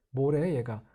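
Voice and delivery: puzzled muttered reaction, under the voice; baffled. Acted. Native speaker baffled by a foreigner's pronunciation, puzzled. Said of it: "원어민들이 굉장히 당황해하시는 거".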